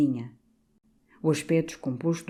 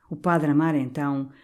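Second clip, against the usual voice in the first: usual speaking voice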